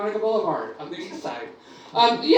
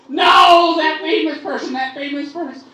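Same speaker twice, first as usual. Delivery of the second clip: higher, louder insisting fan; insistent fan; 7 semitones higher, 9 dB louder than usual. Retold or imitated. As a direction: press the point impatiently.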